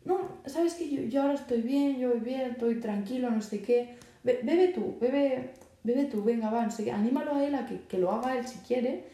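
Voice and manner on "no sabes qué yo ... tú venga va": higher, breezy friendly brush-off; lightly voiced teen turning down a drink kindly. Shown as a demonstration. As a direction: cheerfully wave off the drink and push it back. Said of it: "Tú, tipo, dile".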